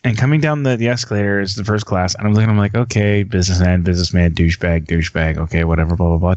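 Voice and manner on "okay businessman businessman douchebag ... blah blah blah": low, dismissive running tally; retold bored inner monologue, pitched down; bored mental checklist. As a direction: tick them off in a low, unimpressed voice. Retold bored scan of passengers.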